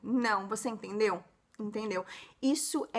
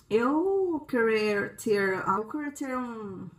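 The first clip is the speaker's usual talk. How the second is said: put-on slow, halting Portuguese with a foreigner's accent; gringo accent; 4 semitones lower, slower than usual. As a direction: grope for each word like a gringo new to Portuguese. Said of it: "Um gringo ... vai falar assim pro vendedor".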